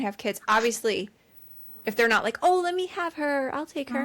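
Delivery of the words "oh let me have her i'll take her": high, sweet mock offer; pitched-up imitation of a keen helper; eager volunteer. Imagined eager offer to hold baby.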